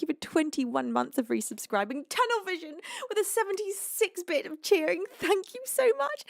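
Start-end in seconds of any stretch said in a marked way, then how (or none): 2.1-6.2 overwhelmed high squeal. Real, not acted. Gifts keep overwhelming her.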